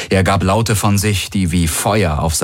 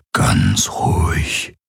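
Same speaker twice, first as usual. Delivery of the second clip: very slow, much lower whisper; slow soothing whisper; 11 semitones lower, much slower than usual. A narrator's reading. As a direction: hush him gently, drawing out each word. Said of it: "flüsterte er".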